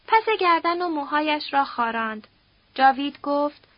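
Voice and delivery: ordinary delivery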